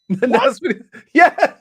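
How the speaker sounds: high laughter breaking through; giggly, words lost in laughter; laughing. Real, not acted. Laughing after impression.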